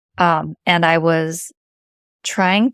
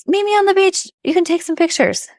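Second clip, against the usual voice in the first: higher, bright call to her daughter; excited calling out; 11 semitones higher than usual. Retold or imitated. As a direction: call out eagerly, pitched up. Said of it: "called Emma and said".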